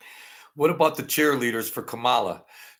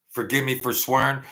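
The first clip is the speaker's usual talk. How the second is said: ordinary talk, nothing marked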